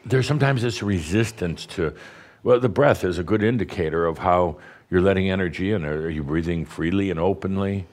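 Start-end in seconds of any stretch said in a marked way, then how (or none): none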